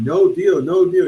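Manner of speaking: mock protester yelling; shouting protester. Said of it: "he was just shouting".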